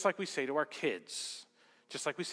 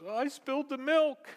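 high mock-upset little kid; lightly acted tearful kid; imitated crying child; 10 semitones higher, 6 dB louder than usual. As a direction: whine it like a child in distress. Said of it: "And he starts crying".

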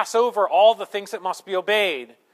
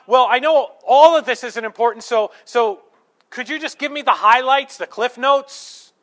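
louder, pushy voicing of a lazy reader; mock impatient plea; 4 semitones higher, 6 dB louder than usual. Preacher voicing the congregation demanding God's highlights, loud and pushy. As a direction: sell how lazy the request sounds, with force. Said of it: "how blasphemous that is".

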